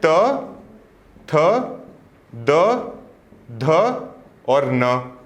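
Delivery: spaced-out recitation, one letter at a time; slow letter recitation. Shown as a demonstration. Teacher slowly reciting the त row for the class. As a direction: let each letter stand alone before the next. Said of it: "तो आप त को क्या बोलेंगे?".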